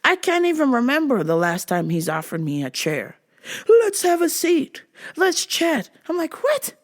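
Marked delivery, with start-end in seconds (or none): 3.7-5.9 high, drawn-out invitation; put-on, over-friendly high voice; eager dad. Newly friendly dad offering child a chair, eager mock welcome. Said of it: "He offered me a seat at the table". 6.4-6.7 very high, quick disbelief; incredulous. Retold or imitated. Adult child baffled by friendly dad, high incredulous squeak.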